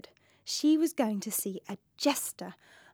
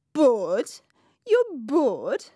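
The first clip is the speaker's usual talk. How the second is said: incredulous; 3 dB louder, much slower than usual. Acted. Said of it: "Pompous was incredulous".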